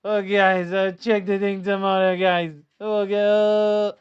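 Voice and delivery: mock him as a malfunctioning robot; mimicking the manager as a Spanish robot at a press conference; Spanish robot impression. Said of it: "he sounds like a Spanish robot".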